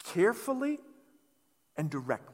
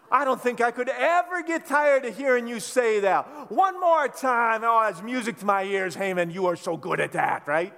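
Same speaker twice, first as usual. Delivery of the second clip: mock praise; 4 semitones higher, 6 dB louder than usual. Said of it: "I'd be like".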